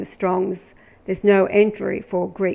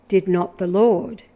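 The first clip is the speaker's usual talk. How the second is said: ordinary talk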